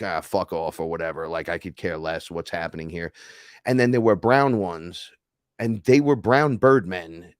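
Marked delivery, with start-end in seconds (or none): 0.0-3.1 low, quiet shrug of a voice; mock indifference, tossed off softly; couldn't-care-less dismissal. Imitating the guy's bored look, dismissive. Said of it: "The dude looked like".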